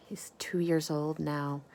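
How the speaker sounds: whispered to the listener; whispered aside. Real, not acted. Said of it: "aside while reading".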